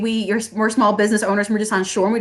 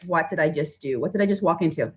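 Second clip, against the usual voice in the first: muttered regret; 6 semitones lower, 7 dB quieter than usual. Retold or imitated. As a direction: lower and much softer, to yourself. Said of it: "you're like".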